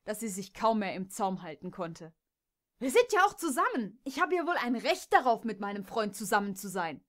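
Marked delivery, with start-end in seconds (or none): 2.8-7.0 loud, higher, indignant outburst that settles at the end; angry defiance. Acted. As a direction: defend your right fiercely. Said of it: "Jess war so wütend". (Jess verteidigt wütend ihre Beziehung gegen die Stuten, laut)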